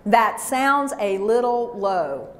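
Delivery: teacher modeling the falling tone for the class; slow falling demo. Shown as a demonstration. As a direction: model it slowly with a falling finish. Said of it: "say this to me right now".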